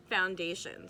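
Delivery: plain reading voice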